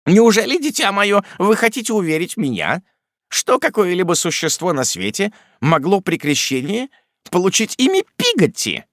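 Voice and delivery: put-on high, scandalised old aunt; incredulous aunt. A narrator's reading. Miss Betsey disbelieving the maid's name, scandalised.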